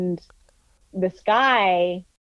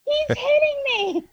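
much higher, urgent call to bystanders; high-pitched retold shouting; shouting for help. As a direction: yell at the crowd to get his plate. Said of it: "And I'm like".

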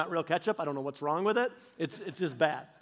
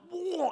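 high mock gag of disgust; high-pitched "whoa" of revulsion; disgusted retch; 11 semitones higher than usual. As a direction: blurt a mock retch, as if spitting out food. Spitting out the ketchup, retold.

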